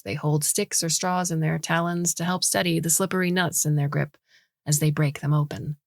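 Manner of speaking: plain reading voice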